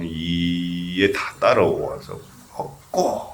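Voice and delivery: "이에" stretched long and low before "먹고"; drawn-out hwadu demo. Shown as a demonstration. Monk shows how to carry the hwadu, drawn-out "이에". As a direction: stretch "이에", then let "먹고" land. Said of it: "이렇게 해야 되는".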